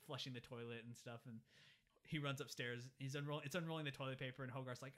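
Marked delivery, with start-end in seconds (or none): none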